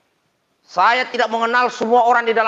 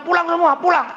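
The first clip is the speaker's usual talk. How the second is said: higher-pitched retold order to leave; retold angry outburst; 6 semitones higher than usual. Husband retelling his outburst in the room, mildly angry. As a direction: mildly re-enact ordering everyone out in a temper. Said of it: "saya ngamuk dalam ruangan".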